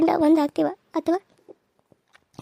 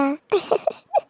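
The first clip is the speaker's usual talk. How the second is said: gloating villain laugh; 3 semitones higher than usual. Acted.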